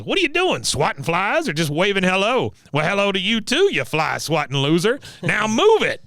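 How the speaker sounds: high taunting old-veteran impression; mocking drill-sergeant old man; Cotton. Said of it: "He just laughs at her and goes".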